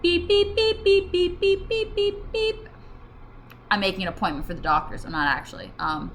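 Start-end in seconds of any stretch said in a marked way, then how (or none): 0.0-2.7 string of high, squeaky beeps; high beeping. Real, not acted. Setting up the doctor example, high repeated beeping. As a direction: beep like a little machine. Imitating phone or vocal tic beeps.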